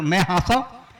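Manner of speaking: normal talking voice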